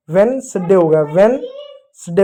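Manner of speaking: वेडनेसडे as two-syllable vens-day; silent D. Shown as a demonstration. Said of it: "डी silent होगा". (डी silent रखकर "वेडनेसडे")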